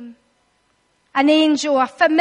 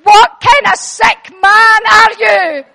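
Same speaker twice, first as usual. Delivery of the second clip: loud, laughing disbelief yell; hammed-up shocked teenager; Isaac's shocked outcry; 4 semitones higher, 9 dB louder than usual. Isaac shouting at his father after being untied, in hammed-up shock. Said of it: "the boy's going".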